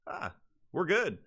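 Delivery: high, light and carefree; breezy. Retold or imitated. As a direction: toss it off like no big deal. Retelling arrival, feeling fine.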